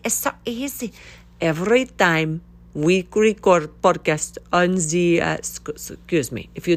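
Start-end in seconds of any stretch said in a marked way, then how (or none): 0.0-0.9 higher, softer, put-on voice on so; mock breezy. Shown as a demonstration. Mocking how easy stripping audio seems, in a silly voice. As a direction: toss it off like it's nothing.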